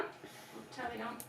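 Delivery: quiet, drawn-out repeat; hushed slow echo; soft slow echo. Pretend. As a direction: murmur it back slowly. Mum echoes softly and slowly.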